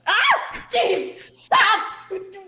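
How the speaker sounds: high startled yelps; scared shout. Acted. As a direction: jump out of your skin. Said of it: "You keep, like, freaking out".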